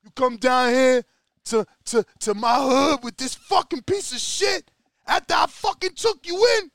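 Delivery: hammed-up street yell at the traitor; Rocky yelling. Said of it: "Rocky's like".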